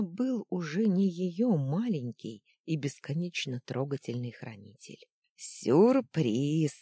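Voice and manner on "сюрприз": cheerful booming bass. Acted. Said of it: "жизнерадостным басом прогремел Фитчик".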